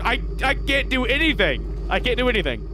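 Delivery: high-pitched exasperated rant, hammed-up; indignant mock rant at the chat; mock outrage. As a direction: play the wronged victim, pitch climbing. Mock outrage over yawn accusation.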